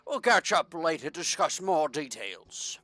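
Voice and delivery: a light British mayor giving instructions; lightly British, businesslike; British accent. Acted. Mayor explains the damaged boat, light British accent. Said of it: "I don't know why I'm Br-, Br-, British".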